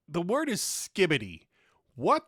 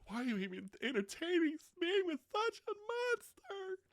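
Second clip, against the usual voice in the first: much higher and softer, a whiny cry; mock wailing outrage; 12 semitones higher, 10 dB quieter than usual. Ladies' friend, mock-crying over the monster. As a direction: wail it like a melodramatic victim. Mocking outrage at picky guys.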